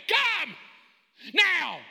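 high shout, word by word; forceful command. Shown as a demonstration. Preacher showing how the word really goes, forceful command. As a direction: bark it as a non-negotiable order. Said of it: "It's this way".

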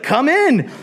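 high, welcoming king voice; lively royal welcome; eager king. Acted. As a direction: call her in warmly like a pleased king. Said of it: "He's like".

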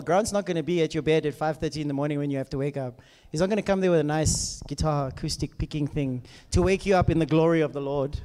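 usual speaking voice

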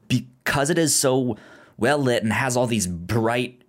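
ordinary talk

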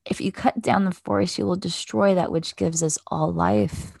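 plain reading voice

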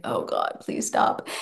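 creeped-out whispered plea; quiet dread. Retold or imitated. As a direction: beg under your breath. Mum dreads the repeated question.

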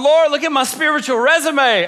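loud, high indignant appeal; hammed-up exasperated prayer; mock pleading. Acted complaint to God.

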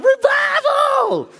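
very high, stretched-out cheer; drawn-out celebratory yell; joyful shout. Retold or imitated. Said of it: "we'd be like".

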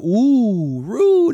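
high, loud, swooning "Ooh, Rue"; hammed-up fan squeal; mock excited gasp. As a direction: gush like a starstruck fan. Mocking fans' excited reaction.

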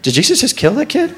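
high, rising mock-shocked question; crowd murmur, incredulous. Onlooker in the crowd about Jesus, mock shocked. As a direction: squeak out a scandalised bystander's question. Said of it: "A murmur ran through the crowd".

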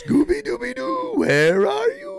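high-pitched cartoon dog call; Scooby-Doo. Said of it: "That was an impression of a".

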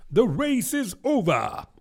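high-pitched announcement call. A narrator's reading. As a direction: call it out suddenly to everyone. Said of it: "suddenly called out".

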